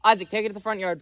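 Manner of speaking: loud, high repeated command; shouting. Real, not acted. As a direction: yell it again, losing patience. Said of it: "I said that three times".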